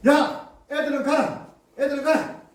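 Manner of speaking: loud, high cry to drive them off; shooing shout. Acted. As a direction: shout like disciples chasing children away. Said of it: "제자들이 한 소리로 다 하는 거예요". (쫓아내는 높은 고함)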